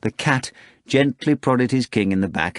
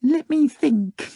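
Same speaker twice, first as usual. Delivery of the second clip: meekly; slower than usual. A narrator's reading. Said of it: "the cat replied meekly".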